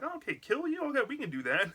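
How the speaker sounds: high-pitched, playful double take; mock surprise. The girls answering the dummy, in mock surprise.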